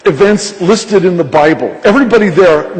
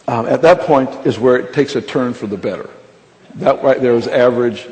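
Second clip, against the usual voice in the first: ordinary talk, nothing marked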